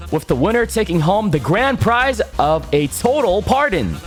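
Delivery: big game show promo voice; booming prize announcer. Acted. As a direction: sell it like a TV trailer.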